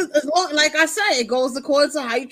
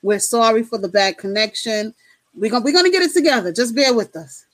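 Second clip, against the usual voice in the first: upbeat call-in show host reading the number; radio host announcement; 4 semitones lower than usual. Acted. Hosts inviting callers to the Ladies Night Show, radio announcer voice.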